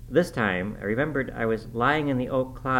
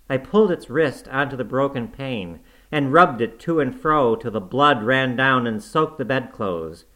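ordinary delivery

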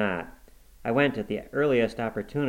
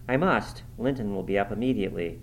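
usual speaking voice

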